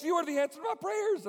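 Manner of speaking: put-on high, loud, hopeful young woman; high eager girl. Amy asking the preacher if he is the leader, high and eager.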